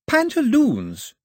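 slow, high, drawn out; slow teaching voice. A narrator's reading. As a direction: spell it out slowly like a teacher. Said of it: "she said".

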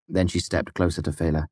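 usual speaking voice

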